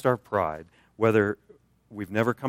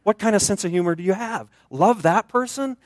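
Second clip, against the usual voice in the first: much higher, disbelieving protest; incredulous at God; 9 semitones higher, 4 dB louder than usual. Retold or imitated. Believer reading the command, complaining to God, high mock incredulity. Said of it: "it's like".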